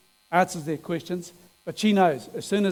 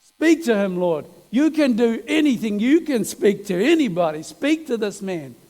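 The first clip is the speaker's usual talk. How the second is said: higher, urgent pleading with God, half-crying; tearful prayer; 4 semitones higher than usual. Retold or imitated. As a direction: cry out to the Lord to speak to him. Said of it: "he's even crying".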